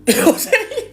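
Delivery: high squealing laugh through the words. Real, not acted. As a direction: crack up mid-question. Laughing at own story.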